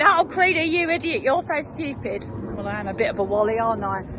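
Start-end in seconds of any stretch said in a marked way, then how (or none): 0.0-2.2 mock frustration. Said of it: "The old me would have got really frustrated".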